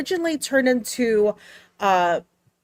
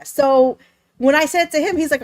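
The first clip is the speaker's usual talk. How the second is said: usual speaking voice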